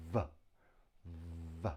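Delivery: V as a voiced buzz, "vvv"; isolated v sound. Shown as a demonstration. Teacher shows how the letter is pronounced, V as a buzzed "vvv". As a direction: sound out V as a buzzing consonant. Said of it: "V, pronounced".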